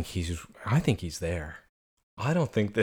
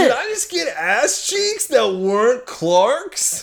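high, slow, drawn-out disbelief; astonished outcry; 14 semitones higher, 4 dB louder, slower than usual. Real, not acted. Seeing someone other than Clark bare, astonished. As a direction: squeal it word by word, slowly. Mock shock at nudity.

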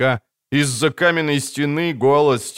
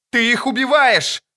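horrified cry; 12 semitones higher, 3 dB louder than usual. Acted. Triss horrified at the killing.

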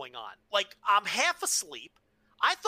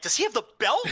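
surprised; 6 semitones higher, 5 dB louder, faster than usual. Retold or imitated. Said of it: "I'm like".

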